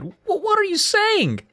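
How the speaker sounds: high, stammered question; stretched, high-pitched disbelief; crying out. A narrator's reading. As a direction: cry it out high and slow. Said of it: "I cried".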